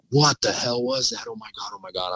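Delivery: hushed, high-pitched freak-out; retold panic. Man retelling his thoughts on hearing the scream, hushed mock panic. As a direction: replay your frightened thoughts, high and soft. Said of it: "I'm freaking out".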